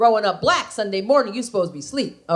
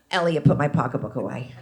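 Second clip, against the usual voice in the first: lower, far-carrying stage whisper; loud failed whisper; 9 semitones lower than usual. Retold or imitated. Comic mother-in-law warning her son about the purse, loud failed whisper. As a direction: hiss it loud enough for everyone.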